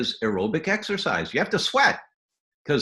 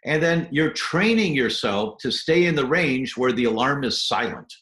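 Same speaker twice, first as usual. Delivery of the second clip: normal talking voice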